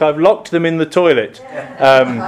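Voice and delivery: ordinary talk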